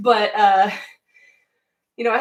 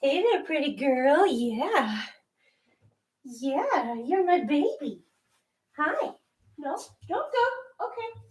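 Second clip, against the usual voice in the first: hushed cooing to the cat; soft pet talk; 7 dB quieter, slower than usual. Real, not acted. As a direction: sweet-talk her quietly. Talking to the cat.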